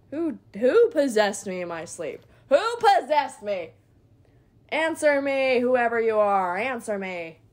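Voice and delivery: loud, mock demanding shout; hammed-up indignant cry; mock outrage. Player pretending outrage at being possessed. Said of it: "what crazy person decided to take over".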